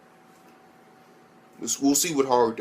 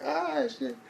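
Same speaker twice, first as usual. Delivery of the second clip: high, thin gasp of surprise, mostly unvoiced in the middle; hammed-up shocked delight, slowing down on the stammer; mock surprised squeal; 7 semitones higher than usual. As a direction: squeak it like you can't believe they went there. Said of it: "I'm like".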